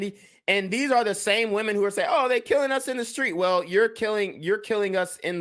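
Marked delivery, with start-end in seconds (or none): 2.1-3.3 high, quick mock complaint; mockingly parroting a complaint; mocking imitation. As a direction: mimic their protest in a raised, hurried voice. Mimicking the women he criticises.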